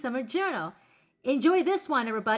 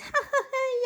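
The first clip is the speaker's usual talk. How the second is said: quick high-pitched giggle; tickled little high titter; 12 semitones higher than usual. Acted. Joker after the nacho cheese punchline, high giggle.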